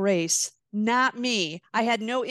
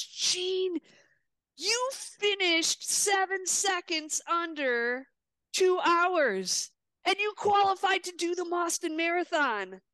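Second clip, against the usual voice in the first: excited coach; 7 semitones higher than usual. Retold or imitated. Coach announces Boston qualification.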